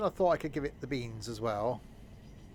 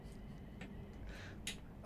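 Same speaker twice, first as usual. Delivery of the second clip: breathy laugh; 14 dB quieter than usual. Real, not acted. Laughs after own accent joke.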